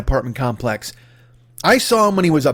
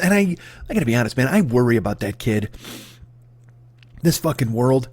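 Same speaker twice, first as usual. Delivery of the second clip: ordinary talk, nothing marked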